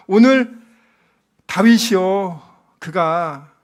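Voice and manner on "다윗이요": ordinary delivery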